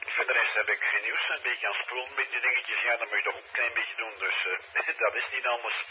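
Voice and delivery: normal talking voice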